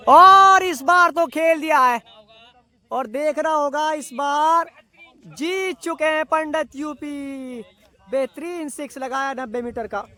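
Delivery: high, slow, thrilled winning call; excited cricket commentary. Real, not acted. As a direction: call the match-winning six. Winning six hit. (जीत पर उत्साहित cricket commentary)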